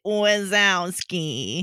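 slow, drawn-out raspy Roz. Retold or imitated. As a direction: drag out the name like Roz. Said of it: "what's her face voice from Monsters, Inc".

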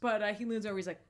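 normal talking voice

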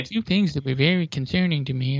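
mocking nasal imitation. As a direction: parrot him in a nasal voice. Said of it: "what's with the nasal voice".

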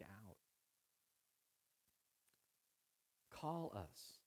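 hushed prayer with long pauses; closing prayer. Real, not acted.

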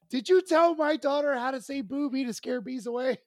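high-pitched accusing demand; incredulous scolding, high but not shouted; imitating exasperated sister. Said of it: "pissed off and laughing at the same time".

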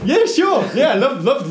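high-pitched, over-keen yes; hammed-up eagerness to please; eager acceptance. Runner accepting a mandarin from gunman, acted over-eager.

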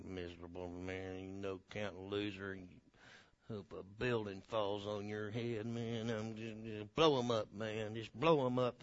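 very low, hushed mock grumble; resentful muttering. Preacher playing the stewing grudge-holder wishing harm, muttering.

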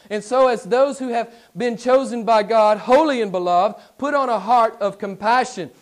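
preacher exhorting the church, loud; full-force preaching. Acted. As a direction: call the congregation to action.

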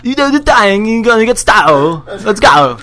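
loud, high mock British rocker; hammed-up British singer cheering on the band. Playing a rock star for laughing friends, loud mock British voice.

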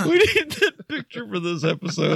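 high-pitched helpless laughter; can't stop laughing; laughing hard. Real, not acted. Uncontrollable laughter at a photo.

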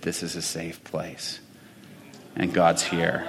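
very soft, near-whispered and moved; quiet and tearful, the girl finally understanding; tearful hush. Retold or imitated. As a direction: breathe it out through tears. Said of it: "tears streaming down her face".

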